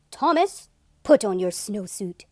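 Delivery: a no-nonsense principal voice; stern principal. A narrator's reading. Principal ordering Thomas into his snowsuit, stern. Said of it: "his very best principal look and said".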